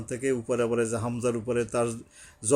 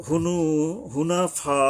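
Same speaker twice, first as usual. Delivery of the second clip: raised Hunafa with a long final aa; four-alif elongation; 5 semitones higher, 3 dB louder, much slower than usual. Shown as a demonstration. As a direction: stretch the end of Hunafa. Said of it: "চারি আলিফ টানতেছে". (হুনাফা চার আলিফ টেনে)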